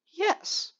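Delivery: sudden high crack on Yes. Real, not acted. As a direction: let the voice break upward on the word. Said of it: "that was a voice crack".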